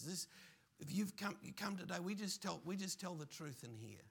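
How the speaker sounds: ordinary delivery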